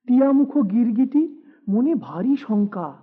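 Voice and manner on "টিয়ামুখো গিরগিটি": ordinary delivery, nothing marked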